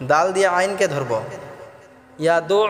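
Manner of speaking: ordinary talk, nothing marked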